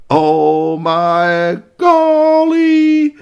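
loud, high, very slow drawn-out gasp of words; drawn-out loud oh my golly; shocked exclamation. A narrator's reading. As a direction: stretch out the shock. Narrator gasps at mess.

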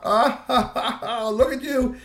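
high, laughing through the words; can't help laughing at her. Acted. Man laughing at troll girl clinging on, laughing. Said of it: "Strongbones couldn't help but laugh".

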